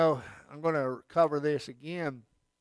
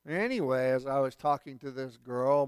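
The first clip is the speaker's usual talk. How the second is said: usual speaking voice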